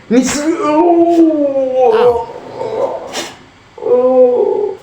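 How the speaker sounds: high, very slow, drawn-out groan; despairing groan. Real, not acted. Gamer losing close to the finish, long high groan of despair. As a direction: let the groan stretch out in defeat. Gamer loses near the end.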